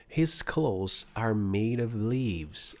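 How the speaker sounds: His clothes are made of leaves read very slowly; very slow classroom reading. Shown as a demonstration. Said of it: "slow read-aloud".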